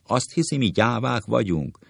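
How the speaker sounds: high indignant yell; shouting. Acted. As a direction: yell it at him, outraged. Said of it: "ordít".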